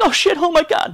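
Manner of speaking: very high-pitched mock overjoyed cry; elated. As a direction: act out overwhelming relief and joy, voice leaping up. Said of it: "the amount of joy and elation".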